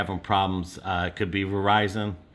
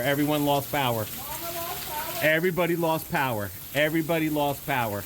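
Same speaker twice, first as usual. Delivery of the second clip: high, frantic shouting over the storm; urgent, rushed alarm as power fails; panicked; 7 semitones higher than usual. Real, not acted. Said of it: "Okay, calm down".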